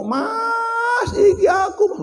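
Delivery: wife calling out. Acted. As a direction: call out to your husband in a high voice. Wife calls husband. (bojo nyeluk bojone kanthi suara dhuwur)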